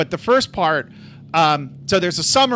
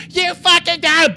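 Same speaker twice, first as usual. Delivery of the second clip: high, loud retold accusation; furious mother; 11 semitones higher, 5 dB louder than usual. Mom accusing her crying son, furious. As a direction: imitate a mom yelling an accusation.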